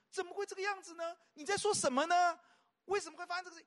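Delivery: high-pitched, insistent questioning; questioning self-talk. Acted. Preacher modelling questions to oneself and God, questioning. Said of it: "有的时候是自我对话".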